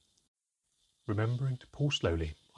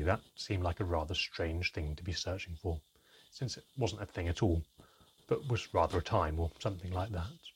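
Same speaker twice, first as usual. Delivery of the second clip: ordinary delivery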